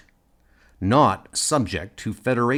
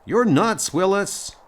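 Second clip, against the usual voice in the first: high, raised-voice call to a reckless friend; exclaiming; 8 semitones higher, 3 dB louder than usual. Acted. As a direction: shout it over to your daredevil friend. Shouting to friend before risky dive.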